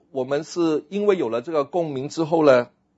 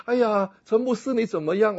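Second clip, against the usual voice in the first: put-on 1 pretty girl voice; 9 semitones higher than usual. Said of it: "她来".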